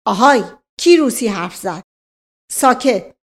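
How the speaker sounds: loud accusing call-out; loud, officious challenge; inspector's shout. Acted. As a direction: demand silence from the whole room. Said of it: "نایب فریاد زد".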